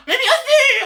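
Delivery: very high thrilled squeal; overjoyed manga fan; ecstatic yes. Acted. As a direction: squeal with delight.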